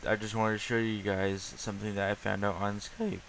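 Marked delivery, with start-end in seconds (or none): 2.8-3.2 voice crack. Real, not acted. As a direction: voice cracks on Skype. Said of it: "On, on that voice crack though".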